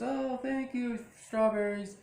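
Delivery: high, gushing imitation; lightly hammed-up high thank-you; mock sweet thanks. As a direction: gush it up high like a sugary wife. Wife thanking for strawberries.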